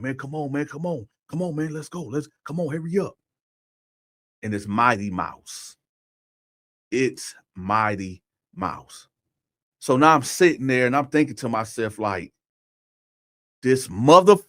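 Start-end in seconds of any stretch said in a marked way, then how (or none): 0.0-3.1 hushed, higher voice, lightly put on; imitated urging. Second voice overheard from the shower, imitated urging. 13.6-14.4 loud punch on the curse; indignant outburst. Retold or imitated. Man in the shower recognising the voice, acted indignation.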